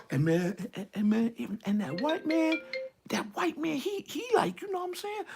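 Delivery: very soft, halting, guarded imitation; hushed and furtive, stumbling over the start; voice goes down. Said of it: "And their voice go down".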